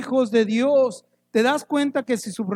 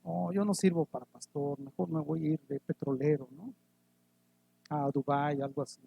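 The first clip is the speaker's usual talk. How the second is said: mock discouragement; 5 semitones lower, 8 dB quieter than usual. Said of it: "nosotros sentimos así como que".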